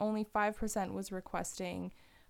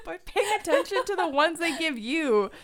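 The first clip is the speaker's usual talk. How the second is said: laughing; 7 semitones higher, 8 dB louder than usual. Real, not acted. Teasing joke, laughing.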